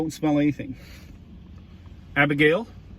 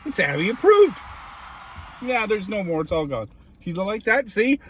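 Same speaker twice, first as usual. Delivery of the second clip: dog talk; 7 semitones higher than usual. Real, not acted. Talking to the dog.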